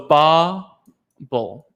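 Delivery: "bubble" drawn out slowly into two clear syllables; slow syllable split. Shown as a demonstration. Therapist sounding out the word for the class. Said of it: "When you write it out in phonetics".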